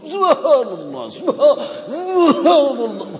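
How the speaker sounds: hammed-up loud, high subhanallah, drawn out; mock ecstatic dhikr. Mocking showy worshipper beside others, loud ecstatic chant. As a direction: overdo it like he's entering heaven. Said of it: "kayak dia sendiri masuk surga".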